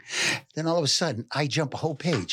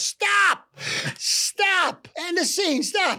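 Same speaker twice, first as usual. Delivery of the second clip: high, loud shout cutting off the scene; urgent director's yell to end the scene; director yelling stop; 3 dB louder, much slower than usual. Retold or imitated. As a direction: bellow it from the back of the room to halt the actors. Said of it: "Don Murray from the back yells".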